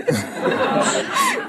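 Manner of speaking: high squealing giggle; embarrassed high laugh. Real, not acted. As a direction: giggle at yourself. Laughs after excuse about oatmeal.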